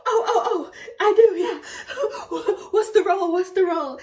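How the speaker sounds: high, loud, laughing stammer; re-enacted breathless excitement; frantic eagerness. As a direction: blurt it out, bursting to know. Re-enacting frantic eager reply on phone.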